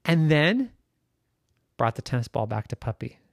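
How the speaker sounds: usual speaking voice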